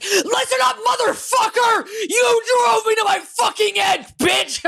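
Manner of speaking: high-pitched screaming rant; furious yelling. Retold or imitated. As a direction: re-enact losing it in a screaming fight. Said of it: "fucking yell at you".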